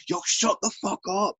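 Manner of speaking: strained, high "shut up" call; imitated shout. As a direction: mimic an irritated crowd member yelling. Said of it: "some guy shouting at them".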